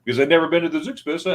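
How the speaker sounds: usual speaking voice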